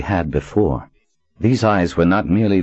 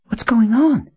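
high, breathless frightened whisper. A narrator's reading. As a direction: whisper it in panic, pitched up. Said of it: "Nadine whispered".

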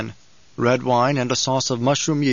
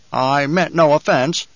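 ordinary delivery

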